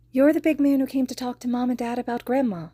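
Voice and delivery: put-on high little girl's voice. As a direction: voice a wary small girl. Child girl voiced in story.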